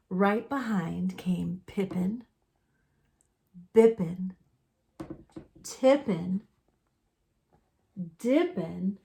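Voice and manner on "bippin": ordinary delivery, nothing marked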